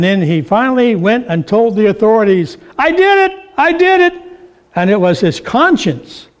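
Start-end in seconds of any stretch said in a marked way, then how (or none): 2.8-4.1 high-pitched panicked blurting; frantic, much higher confession; desperate confession. Acted. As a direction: cry it out like a cornered man breaking. Murderer blurts confession to authorities.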